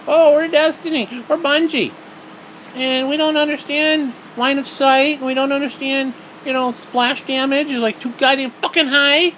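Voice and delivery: lampoon the developers in a high whiny voice; high mocking voice aping smug developers. Mocking the game makers' voice.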